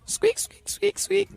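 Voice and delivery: high squeaky mouse noises; mouse squeaks. Retold or imitated. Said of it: "I sound like a mouse".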